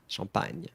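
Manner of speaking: "Champagne" softly as "shahn-PAN-y"; French pronunciation demo. Shown as a demonstration. Teacher repeating the fix, soft French "Champagne". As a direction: keep the a open in "Champagne". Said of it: "not E, A".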